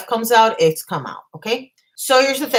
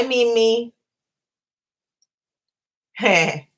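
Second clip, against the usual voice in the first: ordinary talk, nothing marked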